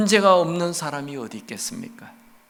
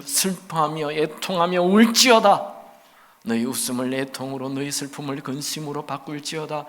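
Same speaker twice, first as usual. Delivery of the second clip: solemn scripture reading; 2 semitones higher, 6 dB louder than usual. Acted. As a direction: read it gravely, rising to a loud finish.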